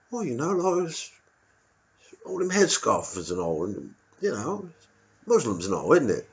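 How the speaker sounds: a bigoted local finally letting it slip; sly East End bigot voice; cagey local. Retold or imitated. Local prejudice.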